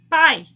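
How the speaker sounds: "pai" projected loudly, purely through the mouth; oral sound. Shown as a demonstration. Teacher demonstrating oral sounds, loud mouth-only vowel. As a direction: say "pai" loud with no nasal resonance. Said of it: "saiu apenas pela boca".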